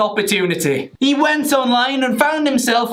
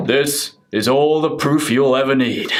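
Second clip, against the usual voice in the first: much lower deep voice; 9 semitones lower than usual. Retold or imitated. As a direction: drop low like a grown man. Deep-voiced boy quoted.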